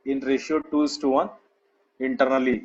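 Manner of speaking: slow, word-by-word dictation; drawn-out words for students writing along. Real, not acted. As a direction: spell out the note slowly, pausing long between phrases. Teacher repeats dictation for note-taking.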